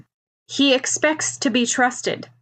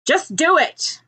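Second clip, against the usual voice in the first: higher, impatient little growl; 7 semitones higher, slower than usual. Acted. Bakugo to Ochako, growling a little to just do it. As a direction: snap it out, a mild growl of impatience. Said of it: "Bakugo growls a little".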